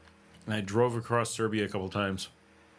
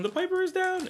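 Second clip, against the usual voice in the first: high announcer call. Retold or imitated. Calling out the missing Piper.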